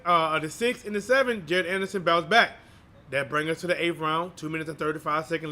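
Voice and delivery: live play-by-play. Acted.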